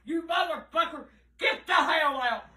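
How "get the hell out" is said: ordinary delivery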